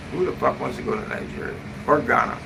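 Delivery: ordinary talk, nothing marked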